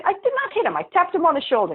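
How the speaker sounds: high-pitched indignant protest; indignant denial. Retold or imitated. Senator retells denying she hit Hiram, indignant and high.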